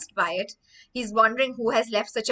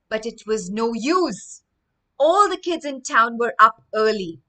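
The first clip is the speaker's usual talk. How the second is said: louder storybook narration; story reading; 6 dB louder than usual. Acted. Teacher reading the story to the class, louder and lively. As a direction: read with rising energy.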